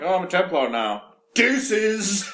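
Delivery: high, loud mock farewell; mocking dismissive Carver. Mocking Carver ditching his sibling, high and flippant. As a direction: toss it off like a smug little brother.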